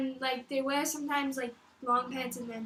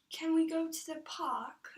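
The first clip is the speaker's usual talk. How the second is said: slow, put-on British accent; 3 semitones higher, slower than usual. Shown as a demonstration. Girl showing off on request, slow British accent. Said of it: "Say something like British".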